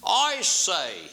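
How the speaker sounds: high, loud, grand opening; impressive manner. A narrator's reading. King addresses the baker in his most impressive manner. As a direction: announce yourself grandly. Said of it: "in his most impressive manner".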